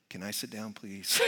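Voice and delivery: low, soft little request; meek child's plea. Retold or imitated. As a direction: mutter it, wanting to be invisible. Embarrassed child wanting to hide.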